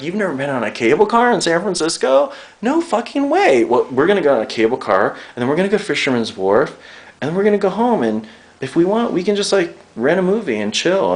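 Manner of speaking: high-pitched acted amazement, then eager plans; excited date planning. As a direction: gush like a boyfriend planning a day out. Acts out imagined excited date plans.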